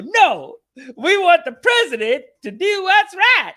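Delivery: high, loud, slow mocking voice; mock stubborn couple. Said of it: "And they go".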